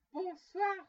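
forceful, insistent send-off; exasperated dismissal. A narrator's reading. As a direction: cut the visitor off loudly. Scrooge's last repeated, exasperated dismissal.

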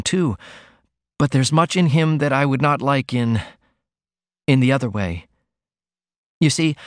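put-on shy young daughter pouring out her worries; young woman Ruth. A narrator's reading. Ruth confides in her mother.

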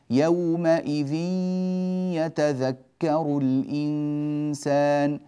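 يومئذ يتذكر with the nasal hum of the tanween flowing into the ya, drawn out slow; nasalized idgham demo. Shown as a demonstration. Teacher chanting يومئذ يتذكر slowly for students. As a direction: stretch يتذكر with a long ghunna. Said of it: "لاحظوا أن الغنة تدخل في الياء".

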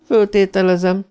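lightly sneering assumption; mocking. A narrator's reading. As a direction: say it with pointed sarcasm. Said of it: "mondta gúnyosan".

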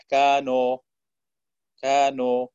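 كانوا ending in an open "o-a" sound; waw mixed with alif. Shown as a demonstration. Teacher showing the waw blurred with alif in كانوا. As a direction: let the waw of كانوا drift toward alif. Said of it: "خلط صوتها بصوت الألف".

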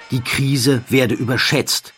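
usual speaking voice

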